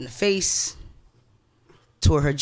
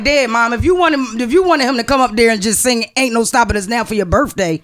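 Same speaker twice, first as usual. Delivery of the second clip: higher, louder joking rebuke to a late mother; mock scolding of mom; 5 semitones higher, 6 dB louder than usual. Grieving son joking to his late mom about her birthday, a higher, louder mock scolding. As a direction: tease Mom for taking Dad on her birthday. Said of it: "I'm like".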